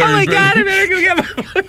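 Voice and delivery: high, fast alarmed gasp; mock panic. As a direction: panic about the boyfriend. Acted panic on phone call.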